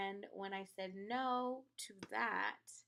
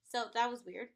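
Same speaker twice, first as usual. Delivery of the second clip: ordinary talk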